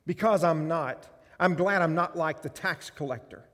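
loud, proud listing of what he's not; loud boasting prayer; smug Pharisee prayer. Acted.

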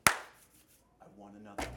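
whispered, slow and sinister; sinister Satan. Acted. Preacher voicing Satan hungry for another victim, slow sinister whisper. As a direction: hiss it like a greedy devil.

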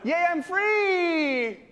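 high, long-drawn mock cheer; slow motion. Preacher voices the sheep freed from a ditch, high slow-motion cheer. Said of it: "Slow motion".

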